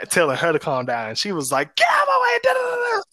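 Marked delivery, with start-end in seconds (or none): 1.7-3.0 much higher, louder crying shout; imitating a raging mother's screech; mock furious yell. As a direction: scream at him to move aside. Mother yelling at stepdad.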